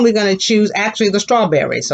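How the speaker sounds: ordinary talk, nothing marked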